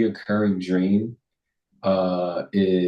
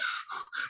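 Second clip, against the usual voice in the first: heavy sigh; 16 dB quieter than usual. Retold or imitated. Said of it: "I'm like".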